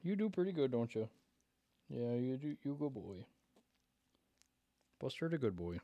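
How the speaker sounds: soft, hushed pet talk with a high start; doggy talk. Real, not acted. Owner softly cooing at his seventeen-year-old dog.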